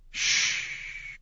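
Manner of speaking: breathy, soft hush; soothing shush. Acted. Madoc hushing the panicked girl, soothing shush. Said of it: "Madoc breathed soothingly".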